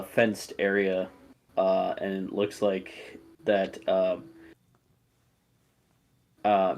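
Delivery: usual speaking voice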